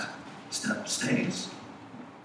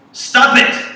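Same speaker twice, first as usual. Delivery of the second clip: shouted order, much louder and higher; sudden shout; 12 semitones higher, 16 dB louder than usual. Retold or imitated. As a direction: yell it abruptly, as the sketch therapist. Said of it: "he shouts to her".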